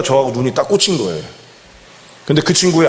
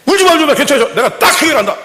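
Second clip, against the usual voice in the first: much higher, louder, breezy and laughing; brash hero voice; 4 dB louder than usual. Pretend. Pastor imagines himself arriving, much higher, louder and breezy. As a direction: burst in like a cocky fixer with a laugh. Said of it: "저 같은 기질은 어떻게 해요?".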